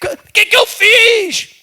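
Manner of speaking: high-pitched frantic cry out to God; acted panicked shout; desperate shouting. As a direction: yell it out in mock despair, way up high, drawing out the last words. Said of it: "não adianta ficar gritando na sua casa".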